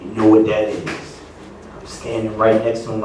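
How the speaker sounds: ordinary talk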